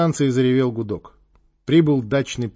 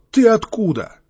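higher, louder startled question; 9 semitones higher, 5 dB louder than usual. A narrator's reading. Sentry yanked from the fence to his captor, startled. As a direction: blurt it out, startled.

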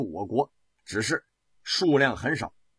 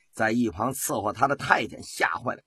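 plain reading voice

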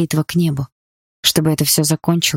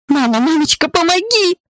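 screaming for mum; 13 semitones higher than usual. A narrator's reading. Terrified girl screams for her mother to help. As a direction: scream for mummy like a scared child. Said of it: "громко закричала я".

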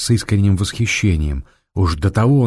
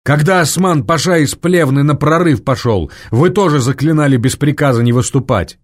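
boastful general; 6 semitones higher, 3 dB louder than usual. A narrator's reading.

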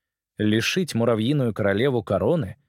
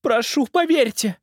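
slow, high, tearful plea; tearful pleading; 4 dB louder, slower than usual. Acted. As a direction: beg through tears. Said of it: "заструились слёзы".

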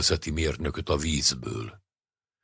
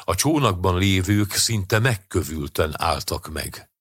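ordinary delivery

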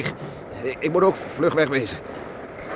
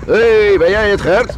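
loud, higher hail across the yard; calling out; 8 semitones higher, 10 dB louder, slower than usual. Acted. The farmer hailing his neighbour across the yard in the storm, loud. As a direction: shout over the wind to the neighbour.

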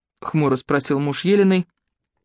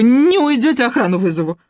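high, loud shriek; 7 semitones higher, 4 dB louder than usual. A narrator's reading. Lyubka shrieks at the visitors, high and loud. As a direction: scream them out.

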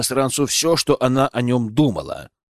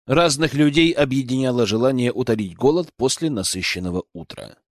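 ordinary delivery, nothing marked

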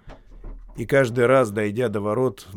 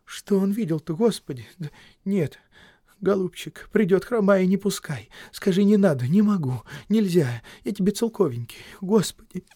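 frightened pleading; 9 semitones higher, 3 dB louder than usual. A narrator's reading. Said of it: "торопливо зашептала, ... странно умоляясь".

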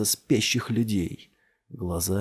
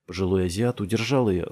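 ordinary delivery, nothing marked

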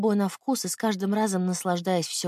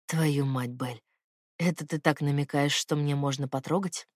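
lightly lowered man's voice, intimate; low male lover voice; 5 semitones lower, 4 dB quieter than usual. Acted. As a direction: speak low as the tender man.

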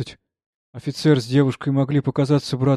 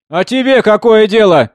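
high, loud, drawn-out taunt; taunting retort; 9 semitones higher, 12 dB louder than usual. A narrator's reading. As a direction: throw it back at him mockingly. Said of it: "передразнивала певица". (громко, дразняще огрызаясь)

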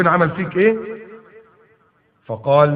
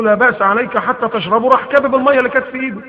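much higher, louder and fast; excited retelling; 9 semitones higher, 5 dB louder than usual. Acted. Preacher to congregation, excited and fast. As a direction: rush it out with delight.

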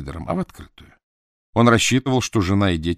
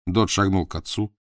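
ordinary delivery, nothing marked

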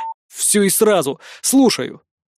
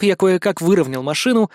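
ordinary delivery, nothing marked

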